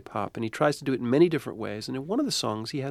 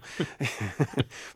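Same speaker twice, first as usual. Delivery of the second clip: short laugh; 5 dB quieter than usual. Real, not acted. Laughs at own accent.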